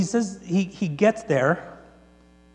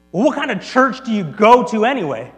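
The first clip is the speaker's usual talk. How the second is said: loud, higher-pitched demand; challenging question; 5 semitones higher, 8 dB louder than usual. Retold or imitated. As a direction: demand an answer, loud and incredulous. Cook demands to know the church.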